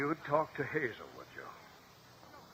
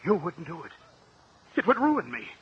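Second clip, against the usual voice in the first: higher, louder desperate cry; frightened desperation; panicked; 5 semitones higher, 5 dB louder than usual. Acted. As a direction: plead in alarm. Panic at threat.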